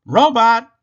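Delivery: loud, high call. A narrator's reading. Rabbit calling to the sleeping Robot, loud. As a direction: call his name to wake him.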